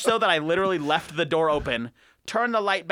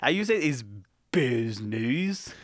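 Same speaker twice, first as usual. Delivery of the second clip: mock French accent; 4 semitones lower, slower than usual. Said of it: "Jean-Pierre".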